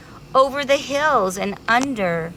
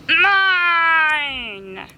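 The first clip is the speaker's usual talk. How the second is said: high, loud, drawn-out bee voice; a selfish bee insisting, much higher and louder; greedy bee; 9 semitones higher, 4 dB louder than usual. Acted. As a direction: hold on to the word, possessive. Greedy bee claims flower.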